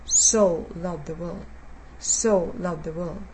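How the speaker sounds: so lifted and stretched, the rest dropping away; so loved the world, with so leaned on hard; stress on so. Shown as a demonstration. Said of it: "The emphasis is on".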